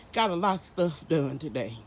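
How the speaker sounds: ordinary talk, nothing marked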